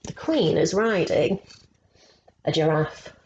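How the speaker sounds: ordinary delivery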